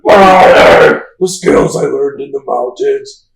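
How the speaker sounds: ridiculous, very loud strained yell; Batman. Retold or imitated. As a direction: overdo a slow, high, shouted vigilante voice. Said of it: "that the ridiculous voice".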